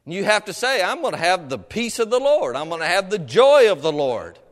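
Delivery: usual speaking voice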